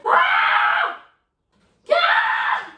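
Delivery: piercing high, loud shriek; terrified scream. Real, not acted. Vlogger screaming at the mouse in her cupboard, terrified scream. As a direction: scream in sudden fright, twice.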